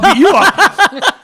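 high, loud, drawn-out comeback; mock threat. Drunk friend answers his buddy's threat, loud mock threat. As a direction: throw the threat back, laughing and hammed-up.

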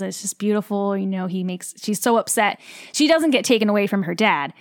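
usual speaking voice

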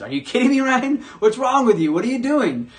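exasperated, high and loud; a frustrated friend calling you out by name; blunt Jesus voice. Retold or imitated. Pastor voicing Jesus scolding him, exasperated. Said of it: "that's how I hear Jesus talking to me".

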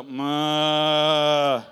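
loud drawn-out cow moo. Shown as a demonstration. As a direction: moo at your neighbor. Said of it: "Look at your neighbor, just go".

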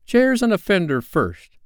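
put-on high, measured boy-leader command; firm high-pitched instruction; giving orders. A narrator's reading. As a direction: lay down the priorities slowly. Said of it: "Peter ordered".

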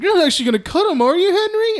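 high, loud, alarmed questioning; mock-worried bully. Nervous bully checking with his leader Henry, mock alarm. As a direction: pitch it way up in mock disbelief.

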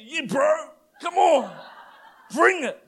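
laughing taunt, egging him on; swaggering mock challenge; cocky bravado. Suddenly brave with big mate.

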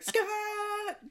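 very high shriek of the name; mock scream. Imitating the screaming lady a second time, shrieking. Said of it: "she's the lady that screams".

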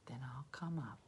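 whispered dog quote. Retold or imitated. Voicing Ruby the dog, whispered. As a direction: breathe the dog's line.

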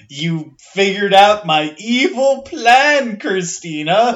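hammy villain, slow and loud. Retold or imitated. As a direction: gloat like a cartoon villain. Villain voice in game recap.

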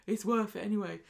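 lightly voiced simple-minded optimist; a hint of breezy, dim confidence; Tim. Husband brushing off his wife's doubts, lightly acted confidence. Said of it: "And Tim's like".

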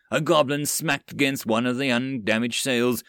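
ordinary delivery, nothing marked

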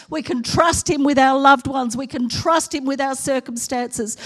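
loud, fervent preaching. Acted. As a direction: proclaim it with full force. Preaching at full force.

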